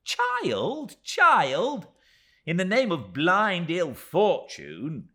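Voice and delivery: high, loud exasperated cry; impatient cry. Acted. Miss Moucher crying out at David in impatience. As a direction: wring your hands and cry it out. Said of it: "cried Miss Moucher, wringing her hands impatiently".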